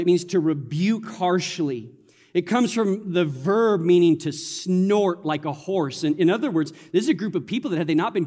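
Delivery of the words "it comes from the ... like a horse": ordinary talk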